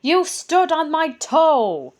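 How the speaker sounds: high, slow, cross goblin voice. Acted. Goblin complains his toe was stepped on, crossly. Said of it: "said the first goblin, crossly".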